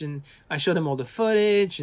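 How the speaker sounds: ordinary delivery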